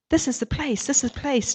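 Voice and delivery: high-pitched, eager repeat; bursting with joy at finding it; excitedly. Acted. Joe telling the pirates he found their new home, excited. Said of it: "Joe said excitedly".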